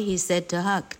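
ordinary delivery, nothing marked

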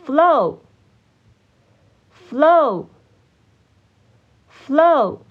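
flow said slowly, long pause after each; repeat-after-me drill. Shown as a demonstration. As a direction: model the word flow for learners to echo. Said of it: "请大家跟我一起念三次". (跟读示范，一词一顿)

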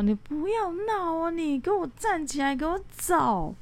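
a high, incredulous order to get up and walk; imitating the friends' shocked command at the hikers who stopped; friends in disbelief. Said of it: "很不可思议的看着我说".

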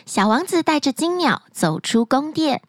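plain reading voice

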